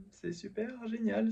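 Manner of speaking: high, soft, slow sarcasm; sarcastic. Real, not acted. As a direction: say it dripping with irony. Sarcasm after a failed sale. (ton sarcastique)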